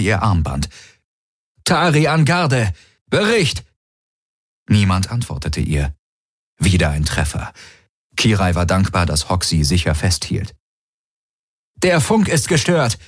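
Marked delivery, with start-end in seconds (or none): 1.6-3.6 high, commanding radio call; urgent command. A narrator's reading. Tari calling the guard by radio, urgent command. 11.8-13.0 high-pitched alarmed announcement; urgent call. A narrator's reading. Tari reporting jammed radio mid-attack, urgent call. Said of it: "rief Tari".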